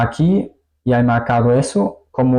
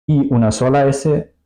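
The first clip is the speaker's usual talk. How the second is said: ordinary talk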